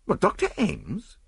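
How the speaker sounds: high, slow, rising disbelief; dumbfounded cry; stupefied. A narrator's reading. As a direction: question it in utter astonishment. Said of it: "I cried, stupefied".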